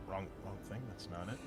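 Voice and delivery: ordinary talk, nothing marked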